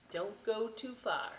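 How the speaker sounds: usual speaking voice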